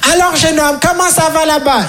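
put-on high, prim old lady greeting. Yvonne greets the young man on the landing, old lady voice. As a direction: chirp it like a nosy granny.

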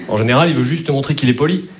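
ordinary delivery

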